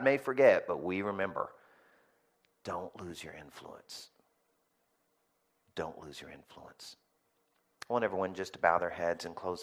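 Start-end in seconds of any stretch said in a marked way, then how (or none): 2.6-4.1 soft plea. Acted. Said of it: "repeated closing exhortation". 5.7-7.0 softer, lower plea. Acted. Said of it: "repeated closing exhortation".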